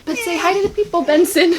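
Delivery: high, sing-song voice for the puppy; talking to the dog. Real, not acted. Owner asking her puppy to greet viewers, sing-song.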